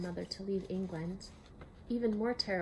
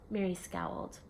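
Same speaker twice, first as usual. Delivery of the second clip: plain reading voice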